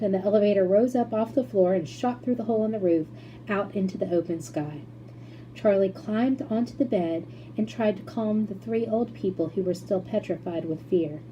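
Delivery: ordinary delivery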